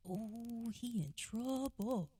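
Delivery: mock-ominous sibling sing-song; sing-song taunt. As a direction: tease like a little sibling tattling. Younger siblings teasing, acted.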